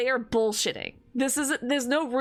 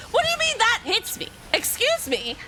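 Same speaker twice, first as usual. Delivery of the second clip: indignant disbelief; 10 semitones higher than usual. Acted. Confronting a friend about emoji tones, with mock outrage.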